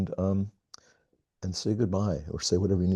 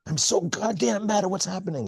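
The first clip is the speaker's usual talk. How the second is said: mock angry outburst. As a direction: act out the rage people voiced in the circle. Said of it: "you start saying".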